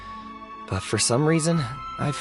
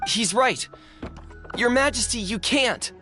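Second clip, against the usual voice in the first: high-pitched worried appeal; anxious pleading; 11 semitones higher than usual. Acted. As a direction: beg a ruler not to go, voice raised in pitch. Joins the protest against danger.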